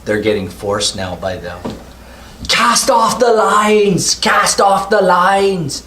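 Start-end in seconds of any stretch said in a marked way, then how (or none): 2.5-5.8 high, slow urgent bellow; gruff shouting over tugboat engine. Acted. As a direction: yell the order over the engine. Bill yelling orders at the dock.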